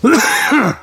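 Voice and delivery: sudden sharp cough; coughing on a bug. Real, not acted. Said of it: "That cough that you just heard was a bug".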